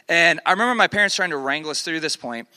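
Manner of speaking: usual speaking voice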